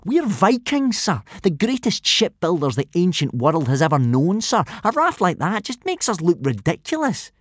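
high sneering scorn. A narrator's reading. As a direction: sneer at their boat. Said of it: "continued the sneering Snotlout".

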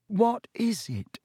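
urgent high-pitched question, drawn out. A narrator's reading. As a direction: press for an answer, pitch jumping up. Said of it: "said Harry urgently".

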